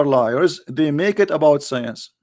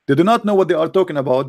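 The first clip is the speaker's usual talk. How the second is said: ordinary delivery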